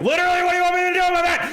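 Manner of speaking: high, loud, fast exasperated yell; exasperated shout. Retold or imitated. Fed-up employee yelling back at her manager, exasperated shout. Repeated review, frustration boils over.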